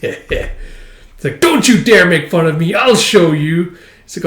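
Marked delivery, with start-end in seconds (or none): 1.4-3.8 loud, raised acted outburst; indignant retort. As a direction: fire back at a teasing remark. Acted retort to being mocked.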